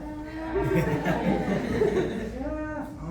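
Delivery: laughing. Real, not acted. Comic laughing.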